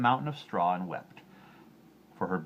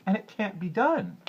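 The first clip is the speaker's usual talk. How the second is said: high, loud sob; a girl weeping at the wheel; sobbing girl; 13 semitones higher, 6 dB louder than usual. A narrator's reading. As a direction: sob it out hopelessly. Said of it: "she sobbed".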